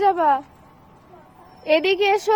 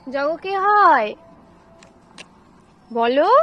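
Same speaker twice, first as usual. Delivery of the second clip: slow, drawn-out ask; 3 semitones lower, much slower than usual. Shown as a demonstration. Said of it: "জোরে জোরে বলতে হয়".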